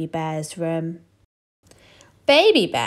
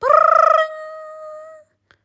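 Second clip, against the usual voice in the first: very high, louder buzzing brrr; alarm ringing; 7 dB louder than usual. Acted. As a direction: ring like an alarm clock. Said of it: "went the alarm clock".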